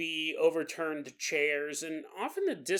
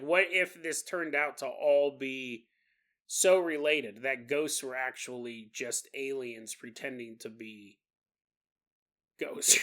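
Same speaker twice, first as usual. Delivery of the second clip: normal talking voice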